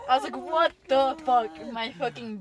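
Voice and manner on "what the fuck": stunned curse. Retold or imitated. Learns the dog gave her away.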